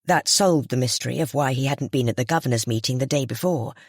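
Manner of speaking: ordinary delivery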